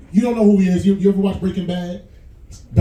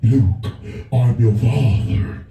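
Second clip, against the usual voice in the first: low, slow heavy-breathing villain; Darth Vader; 10 semitones lower, much slower than usual. Retold or imitated. Snoring fat man sounds like Darth Vader, low and slow. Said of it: "Breathing like Darth Vader".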